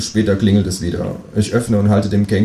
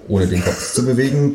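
usual speaking voice

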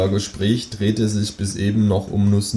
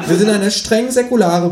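kangaroo voice; 11 semitones higher, 5 dB louder than usual. Acted. Kangaroo explains its group to the narrator, high deliberate creature voice. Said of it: "sagt das Känguru".

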